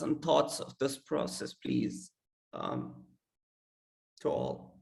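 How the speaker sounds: hoarse voice cracking and fading out; broken voice. Real, not acted. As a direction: voice giving out, breaking. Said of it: "I'm so sorry for my broken voice".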